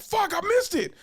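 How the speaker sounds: high-pitched mock yell; frustrated outburst. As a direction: curse your mistake, voice shooting up. Acts out frustrated driver.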